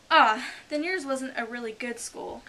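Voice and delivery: loud, high relieved exclamation; great relief. Acted. The Mock Turtle judging Alice's school, relieved. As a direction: let out a big relieved "Ah". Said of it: "in a tone of great relief".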